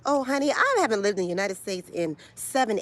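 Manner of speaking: ordinary delivery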